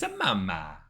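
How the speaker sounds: put-on Italian accent. Player rereading the bartender's tattoo line, Italian accent on Mama. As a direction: slip into mock Italian on "a Mama". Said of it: "read Mama in an Italian".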